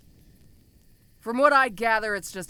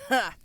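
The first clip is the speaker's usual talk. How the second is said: gloating laugh; 4 semitones higher than usual. Acted. Ogre gloats over prisoner.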